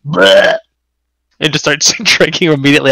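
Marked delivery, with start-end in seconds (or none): 0.1-0.6 retching noise. Retold or imitated. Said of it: "imitating throwing up".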